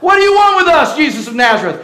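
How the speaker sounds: high, loud demonic cry; possessed man crying out. Acted. Possessed man confronting Jesus in the synagogue, high frantic cry. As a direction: shriek it at Jesus in alarm. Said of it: "cried out".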